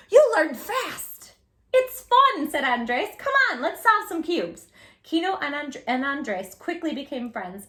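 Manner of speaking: put-on reading a picture book aloud to kids; gentle storytime narration; storybook narration. A narrator's reading. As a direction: tell it like a read-aloud story for children.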